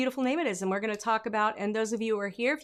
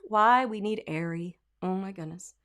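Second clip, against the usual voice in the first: usual speaking voice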